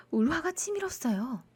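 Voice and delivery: ordinary delivery, nothing marked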